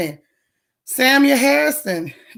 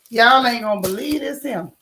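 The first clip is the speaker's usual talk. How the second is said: normal talking voice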